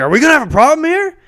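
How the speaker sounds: mock cop threat. Tall cop threatening the short rider. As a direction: loom over him and threaten.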